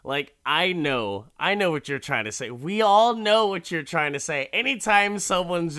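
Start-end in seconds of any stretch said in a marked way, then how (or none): 1.4-5.8 louder, higher ranting; heated, building outrage; fired-up rant. Real, not acted. Ranting about coded racism.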